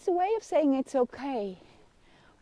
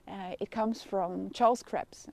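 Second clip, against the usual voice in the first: usual speaking voice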